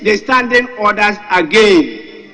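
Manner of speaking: slow it down and command the floor; stern loud order. Real, not acted. Speaker orders member during clash.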